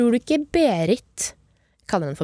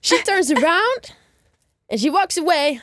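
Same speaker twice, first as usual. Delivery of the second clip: dramatic narration; 7 semitones higher than usual. Pretend.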